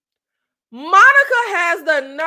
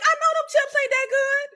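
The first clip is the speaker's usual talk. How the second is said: much higher, mocking inner voice; a sarcastic thought pitched way up; incredulous aside; 9 semitones higher than usual. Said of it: "sitting here like".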